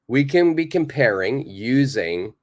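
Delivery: ordinary talk, nothing marked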